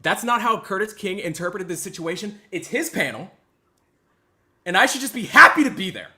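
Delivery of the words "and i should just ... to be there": higher, louder sarcastic cry; mock bitter outburst. Streamer mocking the panel host, loud and bitter. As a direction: blurt it out with fake hurt.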